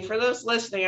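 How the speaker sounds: usual speaking voice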